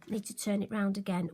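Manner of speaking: usual speaking voice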